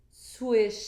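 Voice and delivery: ordinary delivery, nothing marked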